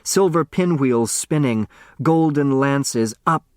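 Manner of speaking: ordinary delivery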